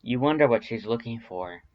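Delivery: ordinary delivery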